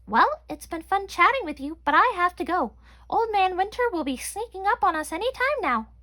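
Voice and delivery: high tiny chipmunk voice; squeaky chipmunk voice. A narrator's reading. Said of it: "Chelsea said".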